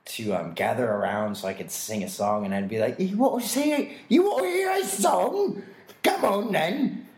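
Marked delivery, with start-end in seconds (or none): 3.0-7.1 high, loud, hammed-up Cockney barker; bad Cockney accent, calling out to a crowd, high and slow; a street barker's hammed-up Cockney, way up high. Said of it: "really bad Cockney accent, screaming at people".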